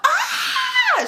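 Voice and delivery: very high imitated shriek; high-pitched, drawn-out mock scream. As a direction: shriek like the crying friend. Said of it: "She just screaming".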